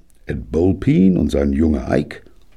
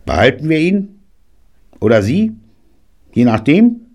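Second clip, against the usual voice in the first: high, loud and sharp; sharp questions to the boy; sharper; 11 semitones higher, 6 dB louder than usual. A narrator's reading. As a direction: snap the questions at him. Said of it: "Dann schärfer zu Tiny".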